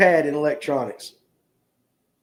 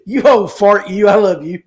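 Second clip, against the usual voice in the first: louder, laughing affectionate jab; laughing tease; 4 semitones higher, 6 dB louder than usual. Real, not acted. Teasing a longtime viewer.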